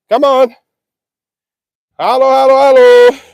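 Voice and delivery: loud high herd call; calling the cows. Real, not acted. Calling cows toward the bucket.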